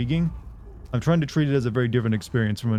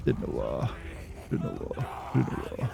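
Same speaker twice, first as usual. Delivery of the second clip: hushed, low creepy gibberish; low gibberish growl; 8 dB quieter, slower than usual. Shown as a demonstration. Streamer tests gibberish to match the game's voice, hushed low croak. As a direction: murmur nonsense softly, aiming for a death rattle. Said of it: "Maybe I just do gibberish and it'll work".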